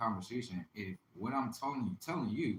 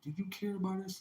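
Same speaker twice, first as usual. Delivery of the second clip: mock whiny plea; 9 semitones higher than usual. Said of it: "They be trying to come to me like".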